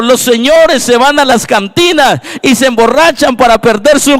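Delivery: high-pitched sermon building to a shouted declaration; impassioned preaching. Real, not acted. Pastor urging the congregation to adore Jesus, impassioned preaching. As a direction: preach with mounting force, drawing out the climactic words.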